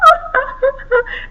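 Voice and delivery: put-on high, breathy child's giggle; high giggling laugh. Tickled girl laughing, high giggles. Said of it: "began to laugh".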